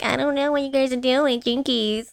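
high nasally Velma voice; nasal nerdy teen sleuth; Velma impression. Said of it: "this nasally voice".

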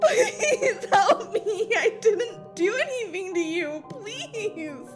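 sobbing pleas for mercy; crying, high-pitched appeals breaking down; tearful begging. Acted. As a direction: beg your attacker through tears. Victim begs tearfully for mercy.

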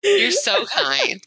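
sarcastic. Pretend. Sarcastic thanks to co-host.

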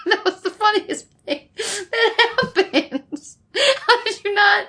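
high, slow words through helpless laughter; can barely get it out for laughing; wheezing laugh, close to tears; laughing till crying. Real, not acted. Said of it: "I'm legit crying".

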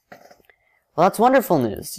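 bright, high burst of delight. A narrator's reading. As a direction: greet the news with real joy. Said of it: "you say in delight".

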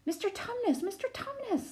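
high-pitched distressed cry; pleading, very high, narrated distress; great distress. A narrator's reading. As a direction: call out in alarm, voice leaping up. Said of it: "said Lucy in great distress".

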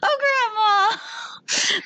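high, drawn-out mock wail; hammed-up plaintive cry; mock dismay. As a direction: stretch it out in exaggerated dismay. Mock reaction to grandma's tactless remark.

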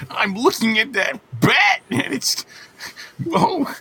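high, breathless swooning voice; lovestruck swoon. Pretend. Acting smitten by the bat.

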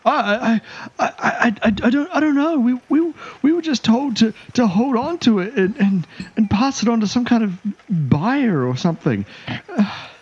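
high, panicky elf voice; frightened elf captive. Acted. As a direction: stammer it out as a terrified prisoner.

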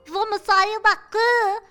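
high, loud, drawn-out cry; Clay's shout. A narrator's reading. Boy yelling to leave the coffins, high shout. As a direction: yell urgently to get out. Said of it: "ouvi Clay gritar".

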